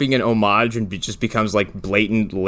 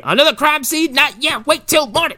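high, lazy mock official; mock mayor; 3 dB louder than usual. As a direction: brush it off in a high put-on voice. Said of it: "Mayor's like".

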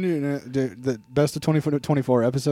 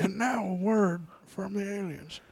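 announcer voice; 7 semitones higher, 8 dB quieter than usual. Pretend. Announcer intro.

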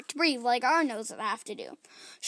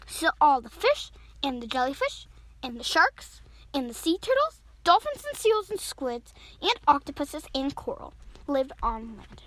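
plain reading voice

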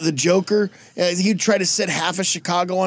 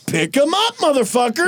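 raised-pitch, drawn-up taunt; mock bullying order; 8 semitones higher than usual. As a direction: boss him around, hammed up. Superman ordering Aquaman, acted.

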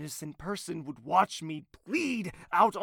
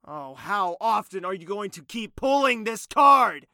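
exasperated; 5 semitones higher, 10 dB louder than usual. Acted. Exasperated retort.

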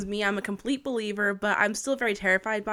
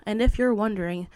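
ordinary talk

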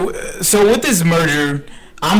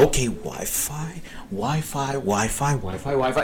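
much softer, under the breath; hushed, repetitive self-talk; muttering to oneself; 3 semitones lower, 10 dB quieter than usual. Retold or imitated. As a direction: mutter it quietly as if scanning for a signal. Acting out hunting for Wi-Fi.

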